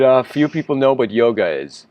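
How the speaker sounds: ordinary talk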